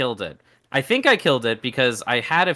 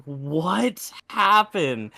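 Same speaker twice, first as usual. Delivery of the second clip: drawn-out stunned question; retold bafflement; 4 semitones higher, much slower than usual. Group after guy leaves, slow mock bewilderment. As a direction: stretch it out slowly, higher, mock stunned.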